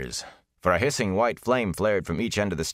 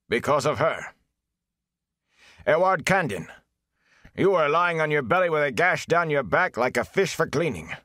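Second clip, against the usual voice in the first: reproachful mayor; 6 semitones higher than usual. A narrator's reading. Mayor rebukes the villagers.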